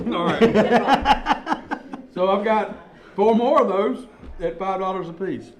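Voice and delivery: laughing through the pitch; chuckling while selling. Real, not acted. Laughing after buying samples.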